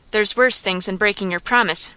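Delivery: ordinary delivery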